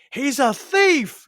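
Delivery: high, loud, drawn-out accusation; acted outraged shout. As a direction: cry it out in mock outrage. Jameson's outburst acted out in retelling.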